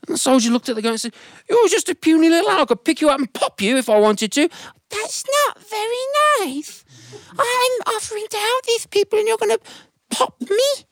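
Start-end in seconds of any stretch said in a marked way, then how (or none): 1.5-4.5 put-on an old soldier sneering at a tiny ant; high mocking dismissal; scornful soldier. 4.9-10.9 a tiny ant wounded by a threat; squeaky offended plea; hurt tiny ant. Acted.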